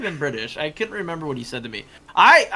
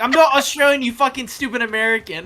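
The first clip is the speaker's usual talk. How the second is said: higher, louder mock rant of an angry player; mimicked angry gamer; 9 semitones higher, 9 dB louder than usual. Player imitates stranger yelling at her in game. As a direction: ham up the offended stranger. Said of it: "And he was like".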